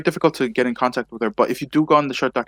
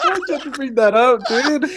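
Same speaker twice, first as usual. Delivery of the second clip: high, loud, laughing complaint; laughing protest; 13 semitones higher, 6 dB louder than usual. Real, not acted. Guest to host over embarrassing gang story, laughing protest. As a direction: protest through helpless laughter, pitched way up.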